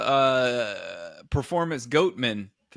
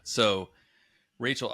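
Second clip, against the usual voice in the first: usual speaking voice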